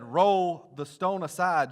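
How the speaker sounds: slow, loud command; Jesus ordering the stone moved, slow and loud. Acted. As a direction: draw out the order with authority. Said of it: "Jesus said".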